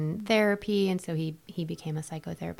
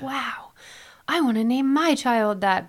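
excited quote; 7 semitones higher, 7 dB louder than usual. Retold or imitated. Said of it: "who are like".

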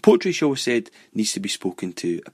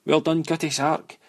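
higher-pitched mock shout; playful imitated yell, pitch raised; retold shout; 7 semitones higher than usual. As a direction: call it out like kids larking about. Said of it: "One of us would shout".

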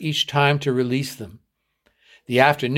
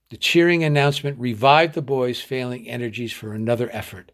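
plain reading voice

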